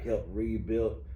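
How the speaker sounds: normal talking voice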